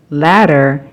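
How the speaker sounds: ordinary talk, nothing marked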